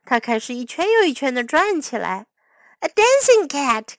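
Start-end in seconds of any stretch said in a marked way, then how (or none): 2.8-3.9 high-pitched delighted exclamation; crying out. A narrator's reading. King watches the cat spin, crying out in delight. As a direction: call it out in amazement. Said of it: "cried King Oswald".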